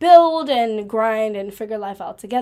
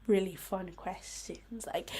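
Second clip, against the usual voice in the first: whispered; 3 semitones lower, 12 dB quieter than usual. Acted. Teasing the guest questions.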